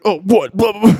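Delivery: loud, dopey guy impression; mock friend's voice. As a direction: blurt gibberish as a caricature buddy. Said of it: "one octave lower voice than me".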